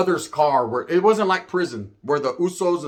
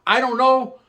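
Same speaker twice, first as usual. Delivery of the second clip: ordinary delivery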